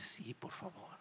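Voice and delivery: breathy near-silent acceptance; whispered yes. Retold or imitated. Stranger whispering yes to the pastor's offer. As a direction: barely voice it.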